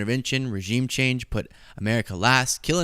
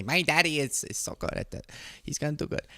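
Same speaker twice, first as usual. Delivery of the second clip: soft, hushed put-on simpleton accent; Eric Trump; 3 semitones higher, 5 dB quieter than usual. Host mocking a son praising his daddy, silly soft accent. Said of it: "that was the accent I chose".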